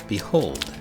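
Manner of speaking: plain reading voice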